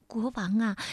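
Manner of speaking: plain reading voice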